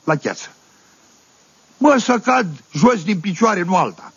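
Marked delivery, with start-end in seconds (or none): none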